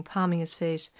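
plain reading voice

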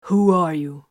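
loud, hard demand. A narrator's reading. Captain confronting Vox about who he is, loud demand. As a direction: push the question, loud and firm. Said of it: "Titus demanded".

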